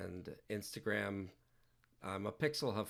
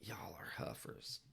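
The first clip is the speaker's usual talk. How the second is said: whisper; 2 semitones lower, 7 dB quieter than usual. Shown as a demonstration. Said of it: "I gotta say that with".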